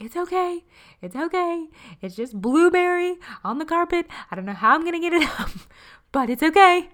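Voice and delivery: high, loud, bright-faced soothing, tumbling fast near the end; exaggerated reassurance. Mom reassuring her crying daughter over spilled smoothie, high and loud. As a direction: oversell "it's okay" like calming a crying child.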